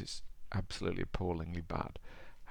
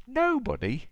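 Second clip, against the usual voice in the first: very high, louder astonished gasp; gasped question; 5 dB louder, slower than usual. A narrator's reading. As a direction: echo it as a shocked storybook question. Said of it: "gasped Mister Happy".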